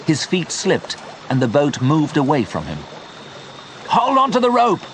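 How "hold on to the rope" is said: high-pitched urgent shouted order. A narrator's reading. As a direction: call out a desperate instruction. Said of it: "Leon shouted".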